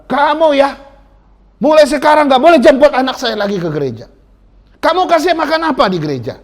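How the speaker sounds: high, loud scolding; angry father. Retold or imitated. Angry father scolding the evangelist at the door, loud and high. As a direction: tell him off, furious. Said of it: "marah-marahin si penginjil ini".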